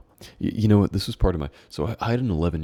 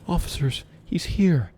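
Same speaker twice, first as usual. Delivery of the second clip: urgent warning; 13 semitones higher, 3 dB louder than usual. Acted. Warning the officers.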